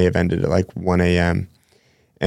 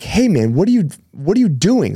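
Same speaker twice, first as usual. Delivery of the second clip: startled call out; 10 semitones higher than usual. Retold or imitated. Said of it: "And I'm like".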